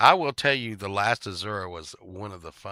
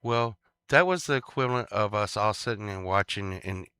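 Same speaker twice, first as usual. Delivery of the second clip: ordinary delivery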